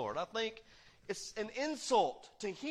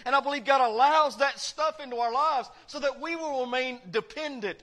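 loud, higher preaching read, climbing in force; full-force preaching; 7 semitones higher, 8 dB louder than usual. Acted. Preacher booming scripture to the congregation, full force. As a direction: preach the verse out to the whole church.

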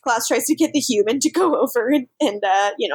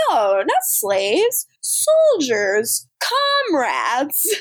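slow grand villain; 2 semitones higher, much slower than usual. Retold or imitated. Klaus correcting, grand words.

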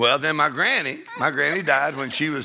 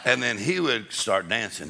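ordinary talk

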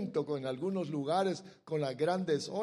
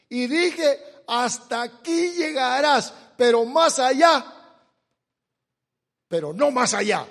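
preaching scripture reading; 5 semitones higher, 10 dB louder than usual. Acted. Preacher reads Job to the congregation, booming. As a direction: proclaim the verse to the congregation.

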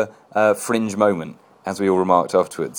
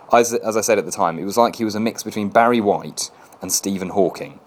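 usual speaking voice

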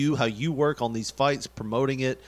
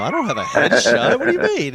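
mock panic; 9 semitones higher, 8 dB louder than usual. Mock panic over headshot request.

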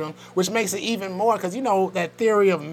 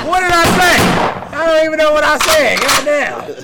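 laughing; 11 semitones higher, 9 dB louder, slower than usual. Real, not acted. Cracking up after his slip.

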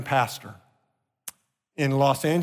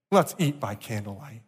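gentle reply; 5 semitones lower, 4 dB quieter than usual. Retold or imitated.

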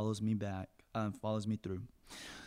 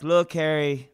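calling out; 4 semitones higher, 9 dB louder than usual. Retold or imitated. Calling through the door.

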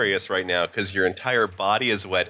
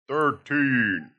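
bad announcer voice; 6 semitones higher than usual. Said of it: "with a really bad voice".

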